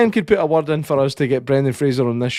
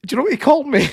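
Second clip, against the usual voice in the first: high, eager setup; excited buildup; 12 semitones higher, faster than usual. Real, not acted. Telling a friend about the neighbour, excited. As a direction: lift the pitch for the reveal.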